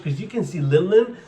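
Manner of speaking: ordinary delivery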